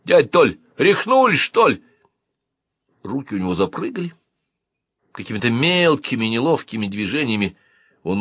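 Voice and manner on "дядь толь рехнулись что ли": put-on high, loud boyish outburst; indignant yell. A narrator's reading. Boy spits out porridge at uncle, indignant yell. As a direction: cry out at a grown-up's madness. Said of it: "и крикнул".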